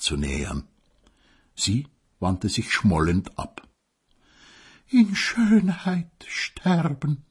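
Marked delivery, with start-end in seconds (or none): 4.9-7.2 mock breathy sigh. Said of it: "hauchte sie noch".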